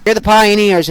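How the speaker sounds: ordinary talk, nothing marked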